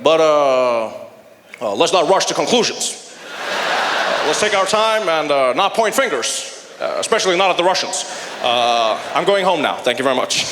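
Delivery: halting, backpedalling presidential impression; imitated statesman dodging the issue; Barack Obama. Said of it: "Obama was like".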